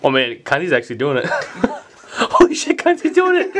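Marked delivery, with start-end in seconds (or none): 1.3-3.5 high, disbelieving cheer; excited cheering. Real, not acted. Cheering a friend's chug.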